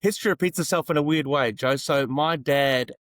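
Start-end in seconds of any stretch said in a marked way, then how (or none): none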